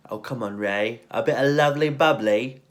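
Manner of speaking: lightly voiced flirty English charmer; imitating Fletcher. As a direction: coax him, playful and cheeky. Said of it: "Hugh Grant's Fletcher's like".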